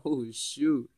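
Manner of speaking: ordinary talk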